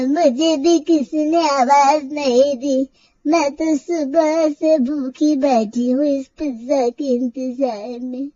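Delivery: put-on slow, complaining old lady drawl; old woman voice. Grandmother complaining she has waited since morning, slow and aggrieved. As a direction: sound like a tired old grandmother kept waiting.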